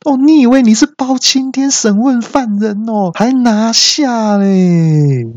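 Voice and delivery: high, indignant mock yell; yelling back. Pinned man mocking the receptionist's order, yelling back. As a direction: stretch the last words into a comic wail. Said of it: "那我就大叫说".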